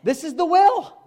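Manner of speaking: high, loud insistence; emphatic declaration. Real, not acted. Preacher insisting to congregation, loud high declaration. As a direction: drive it home.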